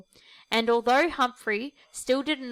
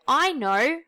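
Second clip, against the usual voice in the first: higher and louder eager shout; excited cry; 5 semitones higher, 5 dB louder than usual. Acted. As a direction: burst out with the idea. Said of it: "cried Boomer the kangaroo".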